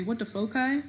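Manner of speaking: "foci" as FOH-kai, for a pun; punning hard-c foci. Shown as a demonstration. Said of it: "people like to say foci, so they can say".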